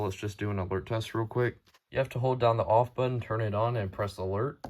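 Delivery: ordinary talk, nothing marked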